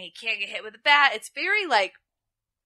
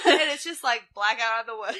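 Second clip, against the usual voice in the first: ordinary talk, nothing marked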